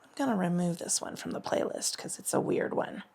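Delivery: unvoiced whispered aside; whispering. Real, not acted. Aside about playlist.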